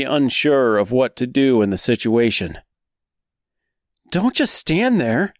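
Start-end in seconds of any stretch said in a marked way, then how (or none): 4.1-5.3 high, frantic scream. Acted. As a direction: shriek it urgently. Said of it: "screamed Dorothy number one".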